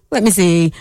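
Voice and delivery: ordinary delivery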